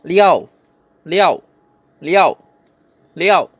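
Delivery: "liao" in a short fourth tone; Mandarin pronunciation. Shown as a demonstration. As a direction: drop "liao" firmly downward. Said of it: "Number six".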